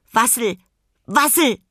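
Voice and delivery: hohe, laute Pinguinrufe; rufender Pinguin. A narrator's reading. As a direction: ruf es hoch als kleines Tier. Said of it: "rief er". (laut rufende Pinguinstimme)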